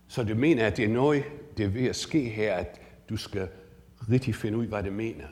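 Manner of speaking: a demo of hearing your own tongue; speaking Danish. Shown as a demonstration. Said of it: "say that one of you is Danish".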